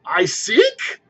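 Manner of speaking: high, indignant exclamation; exclaimed. A narrator's reading. Spirit repeats Scrooge's charge, outraged and incredulous. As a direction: toss the question back in disbelief. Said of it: "exclaimed the spirit".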